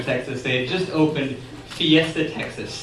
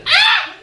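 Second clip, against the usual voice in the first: very high imitated shriek; short mock scream; 5 dB louder than usual. Sister as the raft scooches, screaming. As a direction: yelp a high, brief scream. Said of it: "and she screams".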